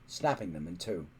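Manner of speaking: ordinary delivery, nothing marked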